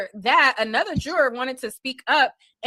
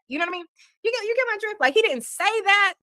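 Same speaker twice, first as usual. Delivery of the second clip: coaxing; 9 semitones higher, faster than usual. Real, not acted. Checking listener gets the hint.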